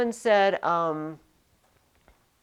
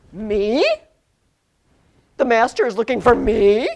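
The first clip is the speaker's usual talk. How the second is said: louder, startled "Me?"; surprised me; 9 dB louder than usual. Acted. Teacher acting the slave surprised he is wanted. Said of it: "they put the word me first because it's emphasis".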